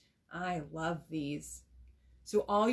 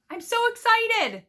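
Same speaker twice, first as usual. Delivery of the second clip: much higher and louder, bursting with joy; excited; 12 dB louder than usual. Shown as a demonstration. Teacher showing the class how to read an exclamation, thrilled and much louder. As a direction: shout it out, thrilled. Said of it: "ends in an exclamation mark ... reading it that way".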